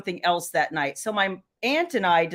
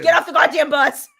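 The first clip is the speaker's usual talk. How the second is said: loud, raised yell at the screen; mock scream; 4 semitones higher, 8 dB louder than usual. Mom screaming at a movie girl, mock furious yell. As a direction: holler it in comic exasperation. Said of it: "I'm screaming at that girl".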